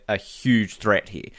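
ordinary talk

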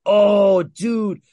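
loud, high-pitched reaction of amazement; excited outburst. Real, not acted. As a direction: burst out loudly, blown away by the gore. Excited reaction to gory movie recap.

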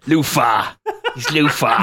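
joking bad impression of a TV character; hammed-up character catchphrase; Luther. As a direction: put on a comic London voice. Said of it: "I thought my voices were bad".

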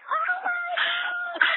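high, tearful shout of joy; tearful shriek of happy news; overjoyed cry. Real, not acted. Both win scholarship, crying out.

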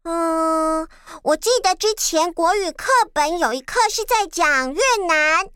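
usual speaking voice

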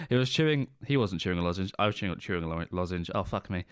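ordinary delivery

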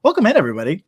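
loud, high host welcome; big showy welcome in; podcast host intro. Acted.

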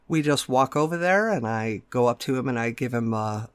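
ordinary delivery

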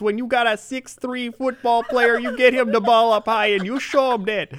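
loud, high Chicago caller rant; Chicago sports radio caller. Retold or imitated. Chicago radio caller ranting about Cutler, loud Chicago accent. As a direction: ham up a fired-up Chicago fan. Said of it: "you talking like you're from Chicago".

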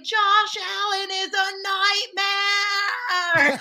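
hammed-up Boston accent howl of frustration; Boston accent yell. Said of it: "the guy just screams, like, frustrated at the top of his lungs in his stupid Boston accent".

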